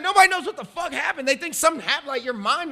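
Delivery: excited retold rant. Retelling the host's rant.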